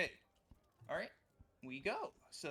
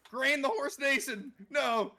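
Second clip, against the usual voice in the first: loud, high, drawn-out wail; a slow, loud lament for the lost horse; anguished shout; 11 semitones higher, 14 dB louder, slower than usual. Real, not acted. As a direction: cry it out slow in mock despair. Mourning horse in game.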